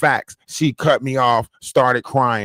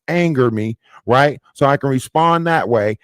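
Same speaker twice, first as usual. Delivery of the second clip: ordinary talk, nothing marked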